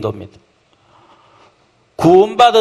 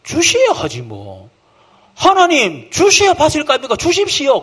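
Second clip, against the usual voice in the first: bold believer's demand; 8 semitones higher than usual. Retold or imitated. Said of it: "복음적으로 생각하면".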